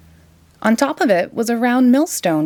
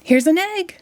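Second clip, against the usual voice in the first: put-on higher, bright boyish call; excited shout; 10 semitones higher than usual. A narrator's reading. Jack proudly brings the egg, excited shout. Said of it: "shouted Jack".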